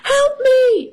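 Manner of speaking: high-pitched yelp for help; hammed-up cry of alarm; yelping Fox. A narrator's reading. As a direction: yelp it like a panicked storybook fox. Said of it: "yelped Fox".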